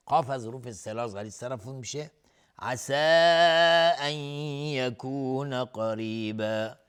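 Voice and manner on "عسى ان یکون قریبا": slow chant, very long aa ending "عسى"; permissible separated madd. Shown as a demonstration. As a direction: stretch "عسى" four or five beats before "ان". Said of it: "مد جایز منفصل به مقدار". (مد منفصل کشیده روی «عسى»)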